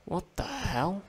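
bewildered high question; baffled and thrown; surprised. Real, not acted. Confused by sudden game end.

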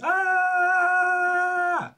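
long, very high-pitched "ah"; imitated baby yell. Uncle showing his nephew's morning wake-up, imitated yell. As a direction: mimic a baby's sustained wake-up squeal. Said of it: "you gotta yell".

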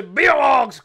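loud, high political chant; imitated crowd-rouser shout; mock rally shout. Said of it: "del que dice".

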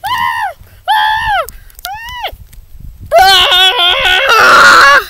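rising high screams of terror; panicked screams. Acted. Startled fish screaming at a robot boss, shrill screams.

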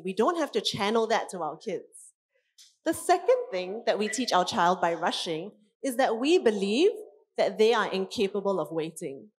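ordinary delivery

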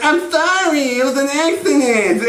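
high whiny nerd apology; Steve Urkel. Acted. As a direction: apologize in a squeaky sitcom-nerd voice. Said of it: "Urkel's like".

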